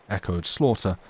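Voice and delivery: ordinary delivery, nothing marked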